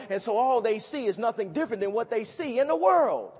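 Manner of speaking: loud soaring sermon question; preaching at a peak. Acted. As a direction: drive the question home loudly.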